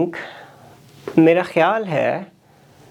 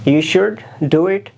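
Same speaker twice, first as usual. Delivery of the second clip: usual speaking voice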